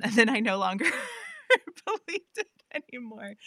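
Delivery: words breaking into a high laugh. Real, not acted. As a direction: laugh through the admission. Laughs at own abandoned dream.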